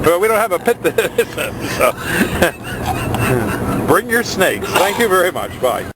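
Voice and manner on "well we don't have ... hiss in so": real laugh breaking into the words. Real, not acted. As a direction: crack up while saying it. Cracking up at the tongue-twister.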